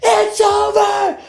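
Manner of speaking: mock sobbing meltdown over a ruined dinner; hammed-up high, slow wail of despair; hysterical crying. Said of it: "hysterically screaming and crying".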